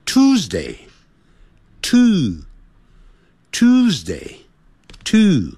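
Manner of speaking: Tuesday split slowly into two; slow pronunciation drill. Shown as a demonstration. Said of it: "念two".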